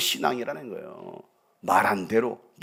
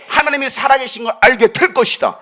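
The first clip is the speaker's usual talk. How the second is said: loud preached declaration; preaching at full force; 8 semitones higher, 9 dB louder than usual. Acted. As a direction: thunder God's promise to the bones. Preaching climax.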